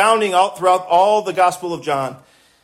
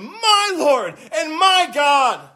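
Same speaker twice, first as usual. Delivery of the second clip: tearful exclamation; 6 semitones higher, slower than usual. Real, not acted. Preacher moved repeating Thomas's words, tearful and slow. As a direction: voice cracking with emotion, slow and high.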